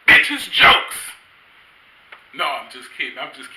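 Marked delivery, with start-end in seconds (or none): 0.1-1.1 slow, loud, shouted punchline; mock shout. Comedian yelling the punchline at his grandmother. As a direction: bellow it like a blunt comeback.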